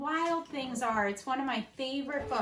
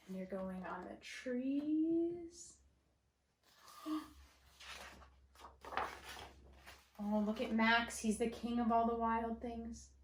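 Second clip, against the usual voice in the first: lively read-aloud storytelling for first graders; reading to children; 4 semitones lower than usual. Acted. Teacher reading to her first graders, animated storytelling. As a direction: read it out like story time in class.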